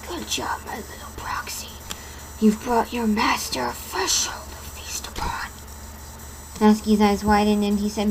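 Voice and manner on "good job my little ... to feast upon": demonic whisper; deep demonic voice. Acted. Slender Man praises his proxy in a demonic whisper. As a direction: breathe it like a monster's whisper. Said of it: "in a deep voice, a deep demonic voice".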